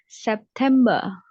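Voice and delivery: September with no R, ending in a plain ah; non-rhotic September. Shown as a demonstration. Teacher demonstrating September without the R. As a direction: drop the final R in September, British style. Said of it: "R-nya nggak kebaca".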